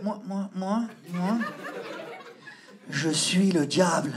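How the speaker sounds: low, slow, quavering possessed voice; trembling voice. Retold or imitated. Possessed student claims to be the devil, trembling low voice. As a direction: let the voice tremble, eyes in the void. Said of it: "avec une voix tremblante".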